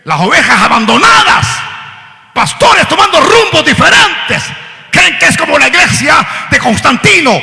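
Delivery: loud, high preacher's shout; preaching at full force. Acted. Preacher warning the church, full-force preaching. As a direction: build to a cry. Sermon climax.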